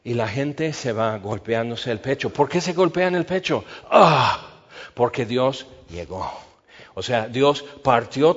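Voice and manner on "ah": loud startled Ah; a loud, sharp Ah; sudden exclamation. Real, not acted. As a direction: burst out with a sudden realisation.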